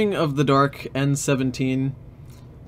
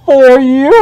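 very high, loud villain roar; Bane voice; 12 semitones higher, 16 dB louder than usual. Acted. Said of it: "That's what Bane fucking says".

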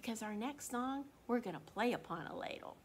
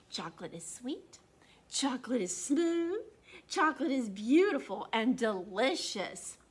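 put-on lively read-aloud for kids; animated storybook narration; story reading; 8 dB louder than usual. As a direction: read it like a picture book to children. Reads a picture book aloud.

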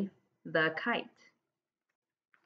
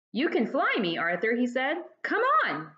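rising excited invitation, high on come on; lightly voiced eager boy; eager big brother; 3 semitones higher than usual. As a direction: coax him along brightly. Stanley eagerly offers to be kite.

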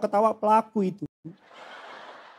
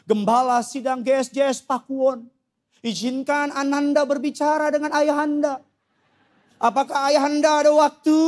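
put-on overly formal child; 4 semitones higher than usual. Child addressing his father with absurd formality, mock-ceremonious. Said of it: "Tidak ada anak yang ngomong sama bapaknya".

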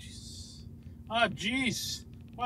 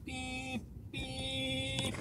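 long, held, softer beeps; mock horn on one sustained note; imitated car horn; 7 dB quieter than usual. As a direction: honk like a car, drawing each beep out. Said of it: "And do that beep, beep thing".

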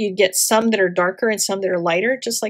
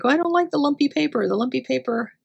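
playful whiny complaint, quick; mock whine; 4 semitones higher, faster than usual. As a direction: whine about the paper, half joking. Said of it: "we're like".

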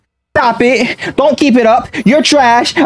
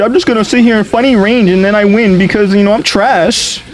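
lightly voiced whiny opponent, higher pitch; mocking the spammers; 8 semitones higher than usual. Mocking range-spamming opponents to his viewers, sneering mock voice. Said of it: "'Cause they, they made it so".

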